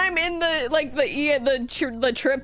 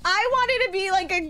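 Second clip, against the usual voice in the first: ordinary delivery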